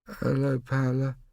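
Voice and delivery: put-on high, mumbled little boy; mumbling child. A narrator's reading. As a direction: say it half asleep. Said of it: "mumbled Bo".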